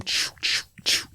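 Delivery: short unvoiced "choo" bursts; clipper noise imitation. Telling friend about trimming his beard, imitated clipper noises. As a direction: imitate trimming with quick airy chuffs.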